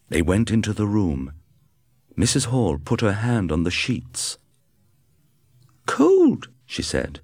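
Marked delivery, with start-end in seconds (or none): 5.9-6.5 much higher, louder wife voice. A narrator's reading. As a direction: say it sharply in her voice. Wife feels the cold sheets.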